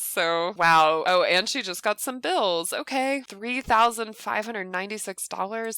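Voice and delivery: usual speaking voice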